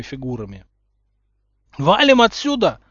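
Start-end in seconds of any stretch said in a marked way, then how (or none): 1.8-2.8 loud, high panicked yell; urgent raised-voice holler. A narrator's reading. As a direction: yell for everyone to flee. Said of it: "заорал Рон".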